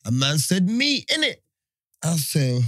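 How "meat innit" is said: higher, tossed-off "Meat, innit?"; higher mock host. Retelling the host's answer, higher mock voice. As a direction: toss it off like the host.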